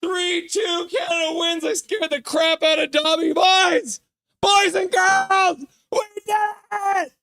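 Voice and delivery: real overtime-win joy, high and loud; breathless, lightheaded cheering; ecstatic victory shouting. Real, not acted. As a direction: yell it like your team just won in overtime. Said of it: "I'm so red in the face!".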